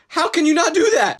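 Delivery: high, incredulous outburst; exasperated disbelief. Acted. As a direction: cry out at their incompetence. Ranting at people who can't cook.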